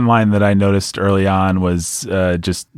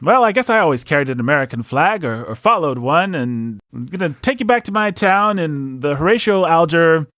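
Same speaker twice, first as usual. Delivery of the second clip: hammed-up patriotic hero; 7 semitones higher than usual. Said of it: "it has the gall to start with".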